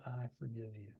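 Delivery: softly, calmly to himself; quiet forgiveness. Retold or imitated. To himself in the mirror, quietly forgiving. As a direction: say it gently into the mirror. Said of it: "I said".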